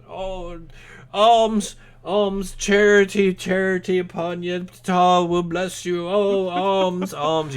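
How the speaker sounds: high, loud wailing plea for alms; pleading beggars. Acted. GM voices crowd of beggars.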